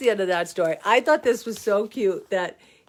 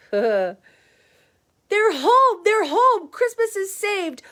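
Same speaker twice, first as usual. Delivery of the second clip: much higher, louder joyful cry; excited cheer; 10 semitones higher, 5 dB louder, slower than usual. Acted. Kitty's family cheering her return, excited high cry. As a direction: shout it like the best news ever.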